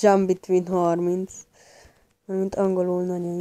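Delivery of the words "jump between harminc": "jump between" in English accent; English pronunciation. Shown as a demonstration. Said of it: "angol kiejtés".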